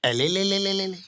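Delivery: put-on cooing at a baby; baby talk cooing. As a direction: coo like a doting uncle. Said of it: "uncle प्यार कर रहे".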